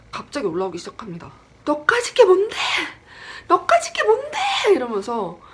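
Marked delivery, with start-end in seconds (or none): 1.6-4.4 acted high, loud scream of defiance; defiant yelling. Dreamer finally lashes out at the attacking ghost, yelling. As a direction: yell it with everything, far above your usual pitch. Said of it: "소리 지르게 됩니다".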